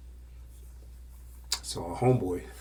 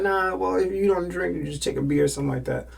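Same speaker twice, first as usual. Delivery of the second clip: put-on higher, louder girly voice, fast at the end; girl's voice; 10 semitones higher, 5 dB louder, faster than usual. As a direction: play the girl pushing a beer. Girl insists, voiced high.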